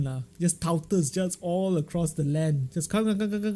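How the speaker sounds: normal talking voice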